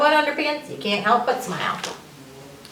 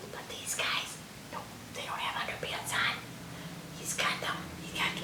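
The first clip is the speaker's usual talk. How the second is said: whispered aside; 16 dB quieter than usual. Real, not acted. Whispered aside about cavemen.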